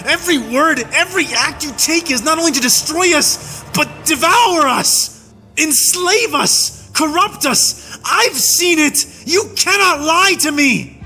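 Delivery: high, relentless angry shouting; raging tirade. A narrator's reading. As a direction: hammer every accusation home.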